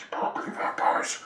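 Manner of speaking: scornful breathy whisper; mocking whisper. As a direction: whisper it with disdain. Mocking whisper about vampires.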